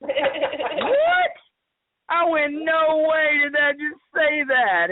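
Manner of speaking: high, drawn-out astonished reaction; retold disbelief. Reliving hearing her name from the box, incredulous.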